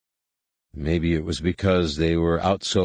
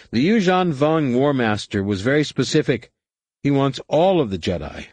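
higher-pitched co-pilot, firmly explaining; treacherous co-pilot; 6 semitones higher than usual. A narrator's reading. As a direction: lay down the enemy's demand in a raised voice.

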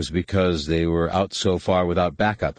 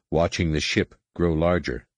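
ordinary delivery, nothing marked

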